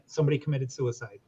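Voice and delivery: ordinary delivery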